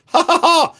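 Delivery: very high mock "ha ha ha"; fake laugh. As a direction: squeal a deliberate fake laugh. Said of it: "I'm gonna laugh on this one, too".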